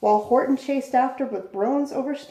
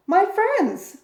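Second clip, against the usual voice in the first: much higher, louder joyful cry; cried out with joy; 9 semitones higher, 5 dB louder than usual. A narrator's reading. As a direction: burst out in relief at finding them. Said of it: "cried the elephant".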